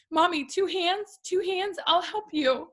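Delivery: put-on high, slow child's voice; tender imitation of a small girl's voice; little daughter voice. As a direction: play the toddler helping mommy up. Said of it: "Huntley comes in and goes".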